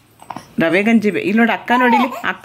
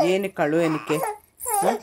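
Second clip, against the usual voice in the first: soft night soothing; 2 semitones lower, 6 dB quieter than usual. Real, not acted. Mother softly hushing her crying baby at half past two at night.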